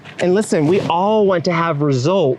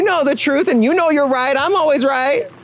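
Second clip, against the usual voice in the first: mock smug, much higher pitch; a hammed-up know-it-all boast; smug and selfish; 9 semitones higher than usual. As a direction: sell it like someone who is always right. Mocking a selfish point of view.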